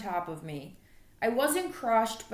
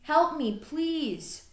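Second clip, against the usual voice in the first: pleading; 6 semitones higher, much slower than usual. Acted. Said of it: "I'm not sure why I was yelling".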